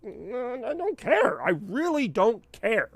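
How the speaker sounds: high, drawn-out I don't care, dripping with boredom; exaggerated indifference. To sales bosses pitting coworkers, mock indifferent drawl. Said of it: "It's like".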